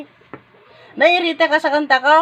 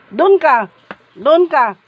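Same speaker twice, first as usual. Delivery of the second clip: normal talking voice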